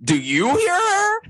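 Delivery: hammed-up Garcelle; mocking Garcelle. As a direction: squeak out the disbelief, laughing. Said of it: "Garcelle being like".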